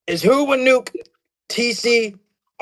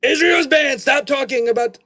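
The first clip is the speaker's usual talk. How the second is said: angry shout; 6 semitones higher, slower than usual. Pretend. Cutting off talk about Israel.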